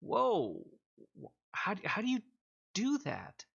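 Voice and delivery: high-pitched, halting disbelief; dumbfounded, tripping over the words; astonished. Acted. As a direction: stammer in awe, voice lifted high. Game master acts amazed smith.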